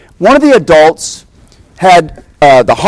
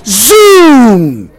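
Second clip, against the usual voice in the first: zoom; 6 semitones higher, 4 dB louder than usual. Retold or imitated. Sound of zipping away.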